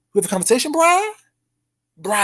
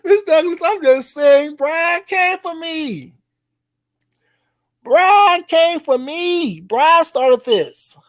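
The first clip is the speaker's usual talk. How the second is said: whiny tattling; 7 semitones higher, slower than usual. Acted.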